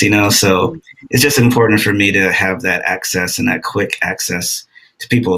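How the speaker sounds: normal talking voice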